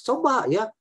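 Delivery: usual speaking voice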